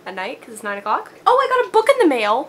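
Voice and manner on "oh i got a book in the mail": high, loud burst of glee; excited announcement. Real, not acted. Vlogger announces a book in the mail, excited. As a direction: sing out the good news, high and loud.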